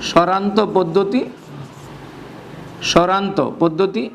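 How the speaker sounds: slow, measured repeat of a term; slow dictation. Shown as a demonstration. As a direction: dictate the term slowly for note-taking. Dictating a term for note-taking. (ধীরে ধীরে লিখিয়ে দেওয়ার ভঙ্গি)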